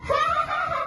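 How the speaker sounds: high, loud taunting ha ha ha; mocking laugh. Mouse Deer laughing at the crocodile, loud. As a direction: cackle at the silly crocodile. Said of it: "Mouse Deer laughed".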